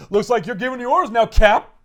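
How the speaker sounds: high, loud acted taunt; movie-line quote. Quoting the boss to Cap, high and loud. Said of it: "That's what he says in the movie".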